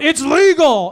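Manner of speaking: high, loud mock yell. Bassist yelling to the crowd before the show, a loud whoop. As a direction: shout it out like the bassist at midnight. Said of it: "he just yelled out".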